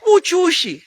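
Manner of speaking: loud, high Zambian-accented "uchushi", a showy flourish; Zambian accent demo. Shown as a demonstration. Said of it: "this accent that I have now".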